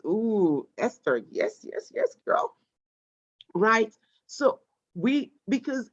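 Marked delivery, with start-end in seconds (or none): 1.3-2.6 very high, soft squeal of praise; excited squeal. Real, not acted. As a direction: cheer her on in a high delighted voice. Cheering a student's chat answer.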